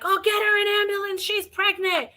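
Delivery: high mock yell of the paramedic; imitating a paramedic shouting across the lot; retold scream. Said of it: "he screams across the room".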